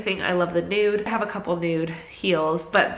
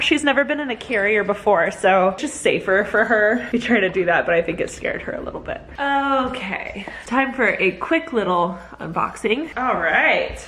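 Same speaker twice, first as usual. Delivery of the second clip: usual speaking voice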